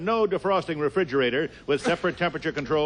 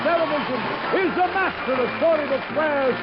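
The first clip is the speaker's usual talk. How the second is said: mock royal decree; 10 semitones higher, slower than usual. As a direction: proclaim it like a royal herald. Herald announcer opening the show.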